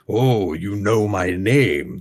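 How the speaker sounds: slow, booming sultan voice; grand languid royal commands. Acted. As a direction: speak like a proud fire lord. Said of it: "I'm having fun doing the Sultan's voice".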